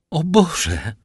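high, loud cry of dismay; exclaiming. Acted. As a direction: gasp it out as a young woman in shock. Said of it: "zawołała Julia".

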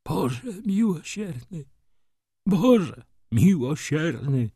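high, slow, wailing cry to God; sobbing plea. A narrator's reading. Broken man writhes and calls on God, sobbing. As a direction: wail it out slowly through acted sobs. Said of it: "powtarzał wśród łkań".

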